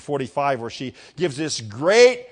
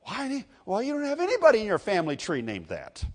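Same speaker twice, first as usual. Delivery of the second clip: mock protest, pitched higher; protesting relatives; 6 semitones higher, faster than usual. Relatives argue over the family name, mildly incredulous. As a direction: object in baffled, higher voice.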